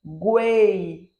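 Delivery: "guei" climbing to e, then falling to i; one long "guei" syllable peaking in the middle; rise then fall. Shown as a demonstration. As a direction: draw out "guei" in an arch. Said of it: "ele sobe e depois ele cai".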